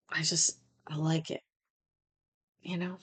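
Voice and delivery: soft whisper, almost to herself; whispered confession. Real, not acted. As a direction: let it slip out under the breath. Confiding aside to host.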